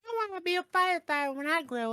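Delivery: put-on little kid voice. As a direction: squeal it out like an eager little kid. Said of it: "every little kid would be like".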